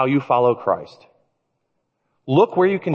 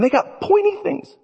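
very high, squeaky mock worry; playfully spooked by a scary fish; comic dread. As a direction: voice climbs high in pretend alarm.

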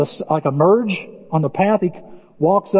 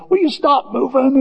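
exasperated plea, pitched up; a higher, put-upon complaint; mock exasperation; 7 semitones higher than usual. Talking to a wandering flashlight in the mouth, exasperated. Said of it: "you're like".

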